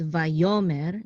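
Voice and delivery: vayomer with YO stressed, va-YO-mer; stress on middle syllable. Shown as a demonstration. Teacher showing how to pronounce this, stress on YO in vayomer. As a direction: lean on the YO in vayomer. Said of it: "I pronounce this as".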